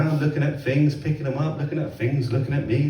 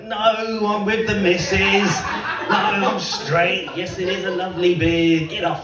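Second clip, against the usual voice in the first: high, slow, drawn-out protest; put-on flattered brush-off; mock coy refusal; 5 semitones higher, slower than usual. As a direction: stretch it out, coyly fending off admirers. Imagines fending off admirers, flattered.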